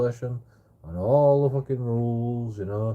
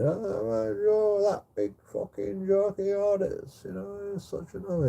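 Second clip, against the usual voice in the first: much higher, very slow, mock-doting mother; mocking mum voice; 12 semitones higher than usual. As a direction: camp it up as the fussing mum, pitched way up. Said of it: "he runs to his mum, like".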